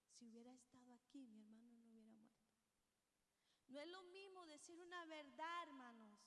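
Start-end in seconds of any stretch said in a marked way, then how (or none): 0.1-2.5 softer and quick, bowed down; humble and lowly. Retold or imitated. Preacher voicing María at Jesus' feet, softer and humbled. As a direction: say it low and humbled, as if prostrate at his feet. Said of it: "con una postura diferente".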